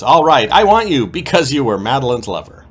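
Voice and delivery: hammed-up dramatic yes; loud soap-opera surrender; giving in. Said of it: "and then she says".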